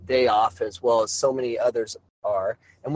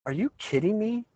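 disbelieving, higher pitched; incredulous; 7 semitones higher, 3 dB quieter than usual. Retold or imitated. Coach scoffing at the official's question, incredulous. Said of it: "And I'm like".

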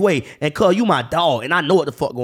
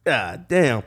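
ordinary delivery

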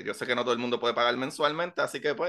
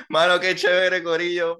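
louder, higher excited outro; 6 semitones higher, 7 dB louder than usual. Real, not acted. Host excitedly closes the episode. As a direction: pump it up for the listeners.

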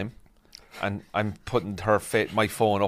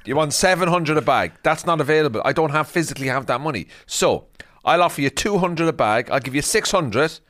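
raised, emphatic bargaining voice; firm mock haggling; 5 semitones higher, 3 dB louder than usual. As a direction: lay down the offer forcefully, in play. Acted haggle, making an offer.

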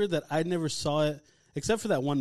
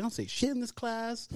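high-pitched mock indignation; indignant protest, pitched up without shouting; retold pissed-off protest; 8 semitones higher, 4 dB quieter than usual. Student protesting that he stays quiet in class, indignant. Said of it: "I was, like, already pissed".

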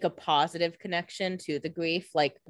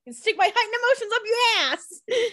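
mock angry shriek; 3 dB louder than usual. Mock retort in the same tantrum.

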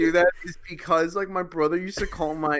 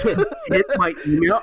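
laughing impression; 5 semitones higher, 4 dB louder, faster than usual. Friend joins the impression, laughing.

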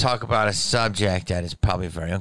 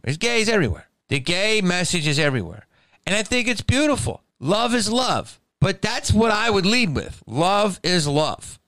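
mock gushing; 11 semitones higher than usual.

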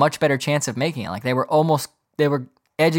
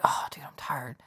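whispered and drowsy; sleepy whisper; 3 semitones lower, 15 dB quieter than usual. Retold or imitated. Woken in the middle of the night, sleepy whisper. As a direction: whisper it like you were just woken.

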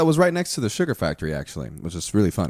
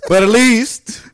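put-on Italian accent; 10 semitones higher, 10 dB louder, slower than usual. Continuing the Italian bit.

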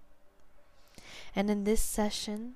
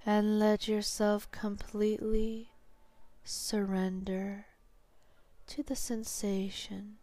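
slow hypnotherapist's guiding voice, long pauses; sleep hypnosis. Real, not acted. Hypnotherapist guiding a listener to sleep, slow and soothing.

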